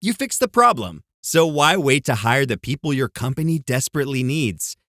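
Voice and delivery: ordinary delivery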